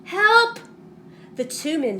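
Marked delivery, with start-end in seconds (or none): 0.0-0.6 high, loud desperate cry of help. A narrator's reading. A lost voice in the forest calling loudly for help. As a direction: call out for help from the dark. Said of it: "came a cry".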